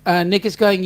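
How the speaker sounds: ordinary delivery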